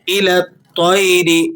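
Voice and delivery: الطَّيْرِ with a full, heavy ط. Shown as a demonstration. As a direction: round the lips on الطَّيْرِ so the ط comes out heavy. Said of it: "موٹے کر کے پڑھے جاتے ہیں". (الطَّيْرِ میں ط کو موٹا پڑھیں)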